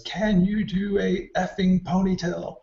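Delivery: high, slow, loud mocking snarl; retold screaming stylist; mock furious yell. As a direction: imitate the stylist screaming in your face. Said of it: "she goes".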